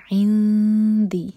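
عندی with the نون hidden, nasal and held before دال; slow loud chanted عندی; hidden nun. Shown as a demonstration. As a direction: ikhfa demo. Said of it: "دال کے لیے اخفا".

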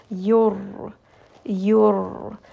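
ra with a light trill on the tongue; trilled Arabic ra; rolled ra. Shown as a demonstration. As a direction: let the r in "Yur" roll briefly on the tongue. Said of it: "you can hear there's a little bit of that roll".

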